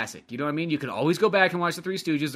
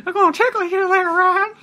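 high, louder, laughing creepy tease; creepy silly voice; 14 semitones higher, 6 dB louder than usual. Pretend. Teasing his cohost, high creepy giggling voice. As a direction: tease him in a creepy squeaky voice. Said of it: "Don't say that in that voice ever again".

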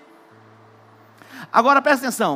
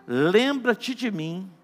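slow pleading; 4 semitones lower, 5 dB quieter, much slower than usual. Acted. Thief pleads with Jesus.

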